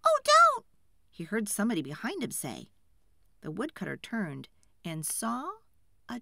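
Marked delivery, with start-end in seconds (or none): 0.0-0.7 very high, loud fairy yelp; alarmed cry. A narrator's reading. Tree fairy stops the woodcutter's axe, alarmed high cry. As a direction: cry out to stop the blow.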